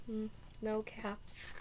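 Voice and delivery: ordinary delivery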